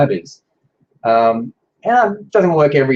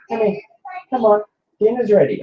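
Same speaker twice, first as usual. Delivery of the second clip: high, raised call through the door; yelling across the room; 10 semitones higher than usual. Real, not acted. Calling daughter into the office.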